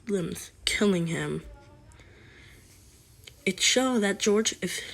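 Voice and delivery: usual speaking voice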